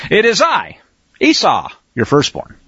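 "It is I", pause, "Esau", pause, "your firstborn", stepping down in pitch; emphasis and pauses. Shown as a demonstration. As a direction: stop after "I" and set "Esau" apart, falling away. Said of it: "put the emphasis on the right syllables".

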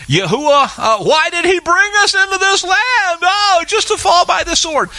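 high, hammed-up complaining whine; mock whine. Mocking the people's complaint against Yahuwah in a high whine.

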